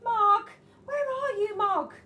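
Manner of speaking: high loud calls into the dark; calling out. Acted. Children shout for Mog in the dark rainy garden. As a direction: call the cat across the garden. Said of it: "They shouted".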